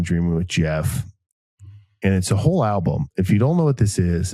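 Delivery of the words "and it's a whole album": ordinary talk, nothing marked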